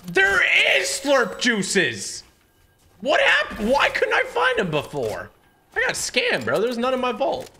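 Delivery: high, loud shocked squeals; excited squeal. Real, not acted. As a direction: shriek in outraged disbelief. Finds slurp juices, feels scammed.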